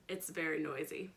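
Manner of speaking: usual speaking voice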